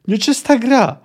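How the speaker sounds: high, loud cry of foul play; indignant yell of unfair play; roaring protest. A narrator's reading. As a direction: roar it like an outraged little mayor. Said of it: "zaryczał".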